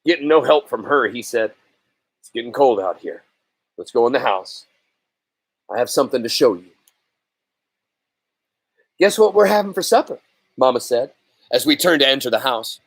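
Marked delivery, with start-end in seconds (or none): 2.2-6.7 lower, steady father voice, hurrying the last line; a calm father coaxing his son inside; father's voice. Acted. 9.0-10.2 higher, bright coaxing mother's question; lifting a sad boy's spirits; cheerful mother. Acted.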